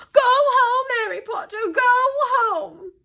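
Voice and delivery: very high, loud wail; squealing elf. A narrator's reading. Dobby begging Harry to leave, squealing. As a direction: cry it out high and desperate. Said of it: "squealed the elf".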